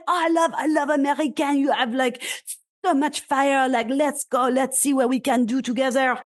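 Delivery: playful put-on French accent, eager and enthusiastic; mock French accent. French distiller gushing about America, in a mock French accent. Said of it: "and I love your French accent".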